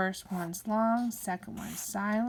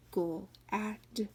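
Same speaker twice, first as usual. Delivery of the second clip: usual speaking voice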